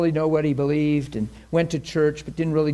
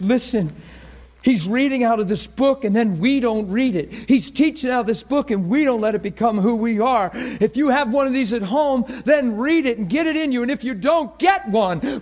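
fiery preacher; 6 semitones higher than usual. Retold or imitated. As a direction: imitate a new preacher on fire. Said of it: "preaches like a preacher with fire".

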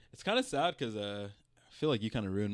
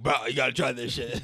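lightly voiced hyped-up bro; a hint of an excited roommate; frat bro; 4 dB louder than usual. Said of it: "My dude came into my room like".

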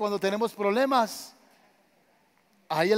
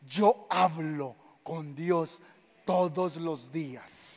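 solemn slow statement; 4 semitones lower, 4 dB quieter, much slower than usual. Acted. Afirmación lenta y solemne.

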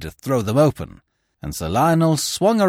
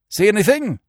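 higher and louder, called over the shoulder; a quick urgent call from the wheel; called question; 11 semitones higher, 4 dB louder than usual. A narrator's reading. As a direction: shout back over the engine. Barton calling back.

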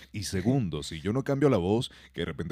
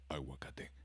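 creepy whisper; 8 semitones lower, 18 dB quieter, slower than usual. Retold or imitated. Riddler's creepy riddle answer.

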